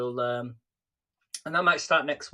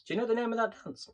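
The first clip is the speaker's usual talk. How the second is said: high quick question; 8 semitones higher, 3 dB quieter, faster than usual. Retold or imitated. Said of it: "you say".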